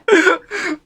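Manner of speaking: high, loud burst of laughing; laughter. Real, not acted. Game master laughing over how great Rufus is, real laugh. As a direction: break out laughing.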